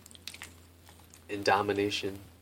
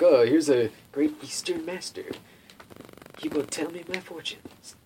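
mock awe; 7 semitones higher, 4 dB louder than usual. Mocks naive visitor's thought.

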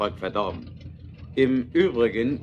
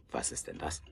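whispered; 11 semitones lower, 16 dB quieter than usual. Acted. Night noise, startled in the dark.

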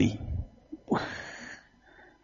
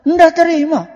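ordinary talk

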